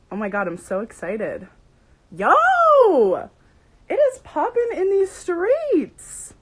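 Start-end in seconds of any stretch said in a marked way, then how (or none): 2.2-6.4 very loud, high, drawn-out whoop; hyped shout. Real, not acted. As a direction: holler it slowly, thrilled by the crowd. Hyped as viewers pour in.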